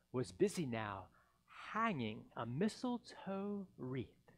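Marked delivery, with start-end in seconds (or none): none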